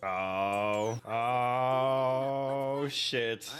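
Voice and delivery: ordinary talk, nothing marked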